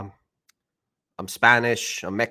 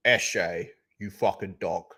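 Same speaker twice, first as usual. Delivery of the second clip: Peter Cullen Optimus; 5 semitones lower, 5 dB quieter, slower than usual. Retold or imitated. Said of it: "We need Peter Cullen to do the voice".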